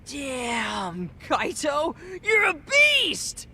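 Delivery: drawn-out high shout of awe; amazed cheer. Acted. Kid cheers Kaito on after the fight, amazed shout. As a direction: build to a long, high cheer.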